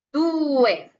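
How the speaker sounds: due with a long u, then a separate e; due vowel by vowel. Shown as a demonstration. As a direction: stretch due into two clear syllables. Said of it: "vocal por vocal".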